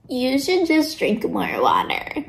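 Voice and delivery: slow, drawn-out know-it-all suggestion; mocking advice. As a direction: parody useless advice, stretching each word. Mocks people's stock health advice.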